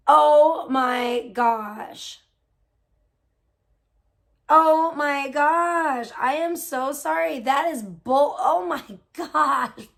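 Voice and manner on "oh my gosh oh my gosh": stunned. Real, not acted. Reacting to viewer's story.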